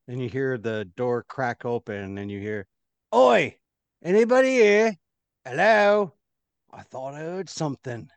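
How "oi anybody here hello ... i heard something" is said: loud rough guard shouting into the room; guard calling out. Acted. Guard at the door calls into the chamber, loud. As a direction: bellow like a suspicious guard.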